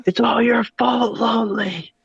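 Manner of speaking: high villain voice, seething; seething shrieking spirit. Pretend. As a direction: shriek out a villain's revenge vow. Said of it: "and be like".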